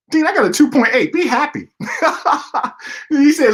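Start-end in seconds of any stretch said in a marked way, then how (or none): 0.1-1.7 high, loud, pleading to be praised; student wanting praise for his grades; excited protest. Retold or imitated. Protesting to the dean. 1.7-3.0 high laugh. Retold or imitated. Laugh after protest.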